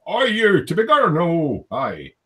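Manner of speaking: lightly mock Irish lilt; Irish accent. Retelling John's chat message in a light Irish accent. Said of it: "but obviously in an Irish accent".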